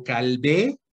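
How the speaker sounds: Calvé stressed on the last syllable, rising; stress on final E. Shown as a demonstration. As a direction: say Calvé slowly, leaning on the final é. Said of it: "con acento en la E".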